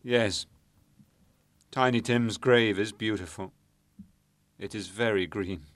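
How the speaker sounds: bereaved father struggling to answer; trembling voice. A narrator's reading. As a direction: tremble through the reply. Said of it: "replies in a trembling voice".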